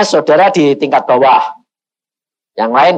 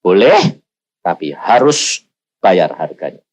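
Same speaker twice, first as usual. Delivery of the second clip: lower, slower voice of God; lightly voiced stern God; 10 semitones lower, slower than usual. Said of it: "Tuhan bilang".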